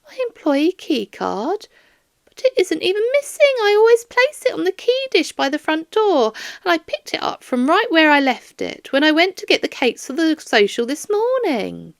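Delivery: put-on a sweet elderly woman who doesn't understand; bewildered old lady. Elderly Doris explains.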